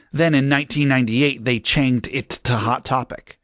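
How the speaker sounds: usual speaking voice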